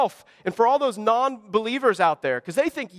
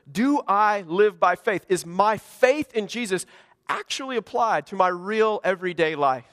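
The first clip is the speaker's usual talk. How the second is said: ordinary talk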